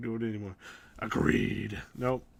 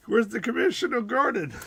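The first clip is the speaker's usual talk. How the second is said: higher hammed-up Russian accent; 9 semitones higher, 5 dB louder than usual. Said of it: "That's Russian voice".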